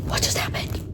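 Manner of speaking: breathless whispered disbelief; shocked hushed question; stunned whisper. Real, not acted. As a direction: whisper it, reeling. Shocked after finishing book.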